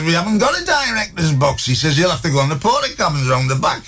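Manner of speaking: high-pitched exasperated refusal; indignant doorman. Acted. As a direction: send him packing, mock outraged. Doorman sends guest away.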